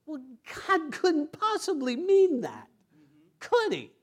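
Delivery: high, incredulous imitation of a doubter; mock the timid believer. Pastor mimics a doubting churchgoer, incredulous and high.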